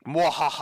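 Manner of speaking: villain's mwahaha laugh; evil laugh. Retold or imitated. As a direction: cackle like a cartoon villain. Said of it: "and it's like".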